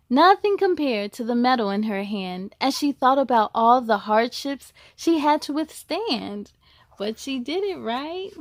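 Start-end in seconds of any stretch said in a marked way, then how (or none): 0.1-6.5 rhyming storybook read with a steady lilt; picture-book narration; reading to children. A narrator's reading. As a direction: read it to a class of kids. Reads a picture book aloud.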